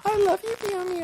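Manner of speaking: squeaky high cat. Pretend. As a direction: purr it up like an adoring cat. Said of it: "I'll try it for Aaron".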